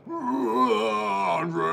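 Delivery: a high, drawn-out cry of the name; excited cheer. Real, not acted. Fan watching the match cheers for Andre, excited cry. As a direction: cheer him on from the couch.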